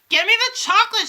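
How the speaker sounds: high, loud bratty demand; demanding shout. A narrator's reading. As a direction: shout it like a spoiled boy. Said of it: "shouted Bruno".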